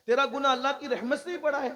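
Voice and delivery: loud, high, rushed challenge; incredulous question. Retold or imitated. Repeats the question in amazement. (اونچی، تیز آواز میں حیرت بھرا سوال)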